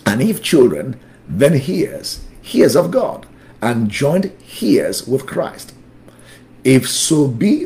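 brisk scripture reading; moving through the verse without lingering; too quick. Real, not acted. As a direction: read the verse out at a hurried teaching pace. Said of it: "I'm going too fast now".

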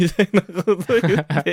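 laughing through the words. Real, not acted. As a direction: chuckle while you say it. Laughing while telling.

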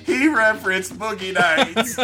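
high, loud, drawn-out tattle; mock tattling child. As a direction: whine it out like a scandalized kid. Continues the tattling child bit.

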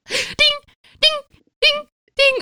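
high, loud sung "ding, ding, ding" like a phone chime; mock text alert. Teasing friend about texts arriving on the toilet, high chiming dings. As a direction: chime it out like notifications pouring in. Imitating phone notification sounds.

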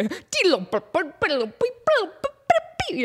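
silly high vocal drum fill; mouth drum noise. Retold or imitated. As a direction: rattle off fake drum hits. More mouth drum sounds.

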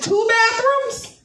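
high, slow astonished question; amazed disbelief. Retold or imitated. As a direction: stretch it out in total disbelief. Amazed at second bathroom.

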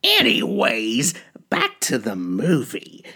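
cackling madman horror host; jack o lantern voice. Acted. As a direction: play the unhinged asylum ghoul. Uncle Wiggles creepy host character.

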